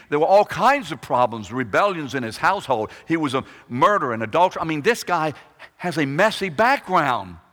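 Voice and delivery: loud, driving sermon build; preaching at full force. Acted. Preacher piles up David's troubles, loud and building. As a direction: raise the voice and drive the list home.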